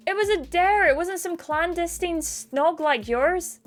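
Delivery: high, indignant self-defence; defensive retort. Acted. Acted quarrel over cheating.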